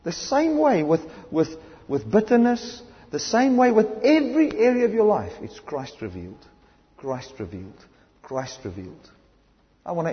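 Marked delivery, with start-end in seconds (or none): 0.0-5.3 high, louder preaching climax; rising fervour. Acted. Preacher driving home his point to the congregation, fervently. 5.4-9.1 softer, hushed repetition of Christ revealed; hushed refrain. Acted. Preacher letting the refrain settle on the congregation, hushed.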